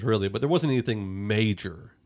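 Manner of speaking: usual speaking voice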